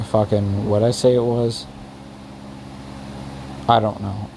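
groping for a forgotten name, trailing off; blanking on lines. Real, not acted. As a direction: stall and search for the word. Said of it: "I'm completely blanking right now".

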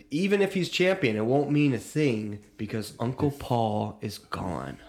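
ordinary talk, nothing marked

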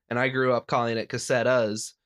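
normal talking voice

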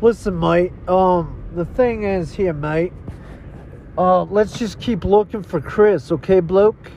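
put-on Aussie bloke accent; hammed-up Australian barbecue talk. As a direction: play a casual Australian bloke. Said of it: "you didn't talk Australian".

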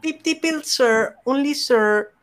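put-on Filipino accent. Giving friends a different line to guess, in a Filipino accent. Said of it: "Is it Filipino?".

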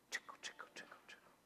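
soft unvoiced whisper of trickling water; whispered trickle. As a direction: breathe it out like a tiny trickle of meltwater. Melting snow sound, whispered.

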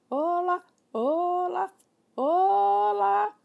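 high, loud parrot squawk from the chimney; parrot calling. Acted. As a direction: call out like a parrot from inside. Parrot voice again.

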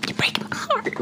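breathy stage whisper. Acted. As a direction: whisper it like a wounded confession. Mock-heartbroken whispered repeat.

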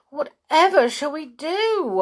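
Molly trapped in the witch's garden; alarmed. A narrator's reading. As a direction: ask it in a fright.

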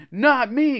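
high, loud protest. A narrator's reading. Greg won't go near the blob, protesting. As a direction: refuse outright. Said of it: "Greg protested".

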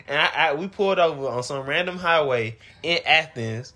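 ordinary talk, nothing marked

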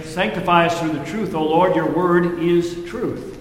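prayer voice. Acted. Congregational prayer.

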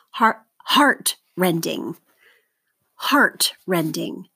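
"heart-rending" slowly, HEART stressed; stress on first syllable. Shown as a demonstration. Teacher models for seventh graders to mark, slow stressed HEART in "heart-rending". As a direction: say "heart-rending" as HEART-rending, dropping after HEART. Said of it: "circle the stress syllable".

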